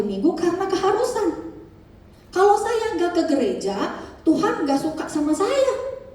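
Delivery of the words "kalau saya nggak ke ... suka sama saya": higher, whiny voice of a believer afraid of God; mock the anxious churchgoer, pitched up; a hint of laughter at the end as he mocks the fretful believer; mock fearful believer. Mocking a fearful believer's thinking.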